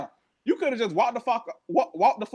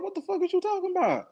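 incredulous; 10 semitones higher, faster than usual. Real, not acted. Incredulous rant at cast.

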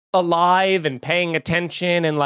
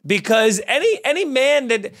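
ordinary talk

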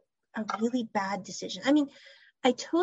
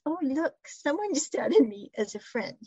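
lightly feigned surprise, higher pitch; mock surprise; 4 semitones higher than usual. [name] teasing Pat after not hitting the button, mock surprise. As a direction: play innocent with a higher, teasing lilt. Acted teasing pretence in retold scene.

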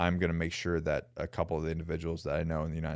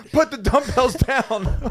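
mock cop command; 9 dB louder, much slower than usual. Imitating the armed cop.

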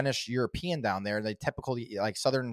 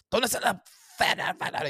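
put-on Spanish accent; 8 semitones higher than usual. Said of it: "He's like".

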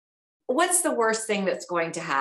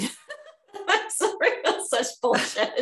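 high-pitched words breaking into laughter; laughing in disbelief; 6 semitones higher, slower than usual. Real, not acted. Reacting to a friend's story of being shushed, laughing in disbelief. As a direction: laugh helplessly through the words.

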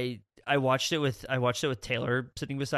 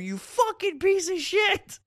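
much higher, slow squawky parrot cursing; swearing parrot voice; 5 dB louder, slower than usual. Retold or imitated. Mimicking the swearing parrot for his cohost, squawky and high. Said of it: "he's just going".

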